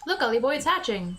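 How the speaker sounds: lightly voiced regional Drippy accent; chummy sidekick in his non-standard English; a hint of a folksy accent; Drippy's accent. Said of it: "writing in that accent".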